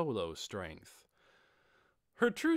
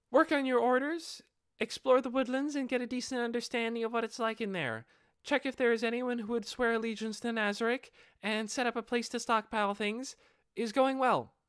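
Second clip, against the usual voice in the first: high, bright-voiced young girl; a mischievous kid answering breezily; playful dark elf girl; 11 semitones higher, 4 dB louder than usual. Aura answering how long she hid, high playful girl.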